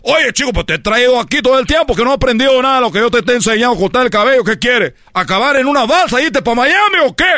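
high, exasperated scolding; mock-furious Cuban dad; scolding father. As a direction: berate the lazy son. Father scolding son in story.

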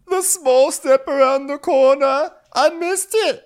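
very high, slow mock wail; hammed-up tearful falsetto; whimpering patient. Kyle explaining his fall to Sandy, high slow mock whimper. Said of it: "He goes".

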